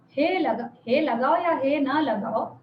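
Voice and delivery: ordinary delivery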